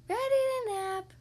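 high, eager pig answering. Acted. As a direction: chirp it back brightly. Piggy answers same words.

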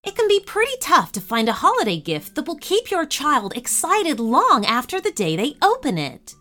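ordinary delivery

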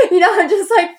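laughing through the panic. Real, not acted. Recalling the failed sale to the host, laughing. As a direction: laugh it off nervously, high. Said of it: "freaking out".

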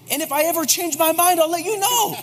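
climbing high into a laugh as the joke lands; laughing through punchline. Retold or imitated. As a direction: deliver the husband's line, cracking up at the end.

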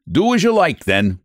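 a hammed-up angry shout. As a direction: bark it at him. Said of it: "shouted Flannery".

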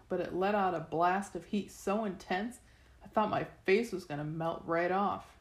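ordinary delivery, nothing marked

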